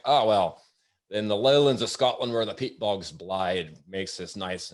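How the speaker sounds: shaky attempt at a Scottish accent. Waiter imitating guest explaining peat bogs, amateur Scottish accent. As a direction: put on a rough Scottish brogue, slowing down. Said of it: "but I'll give it a shot".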